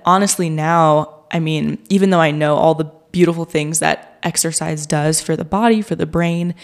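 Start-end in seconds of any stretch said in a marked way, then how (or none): none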